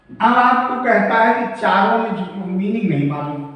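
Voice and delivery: ordinary talk, nothing marked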